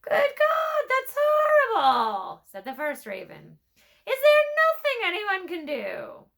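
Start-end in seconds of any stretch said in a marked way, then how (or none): 0.0-2.4 very high, loud shocked squawk; appalled high-pitched raven cry; horrified raven shriek. Acted. First raven aghast. 4.0-6.3 high, loud desperate bird voice; pleading high raven. Acted.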